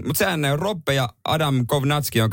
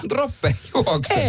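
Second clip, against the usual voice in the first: high, slow, drawn-out commentator call; 12 semitones higher, much slower than usual. Retold or imitated. Host calls Roppe's run like a sports commentator. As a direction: stretch it out like a race caller.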